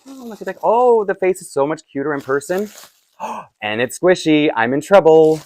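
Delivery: high-pitched gushing over a first look; delighted gushing. Real, not acted.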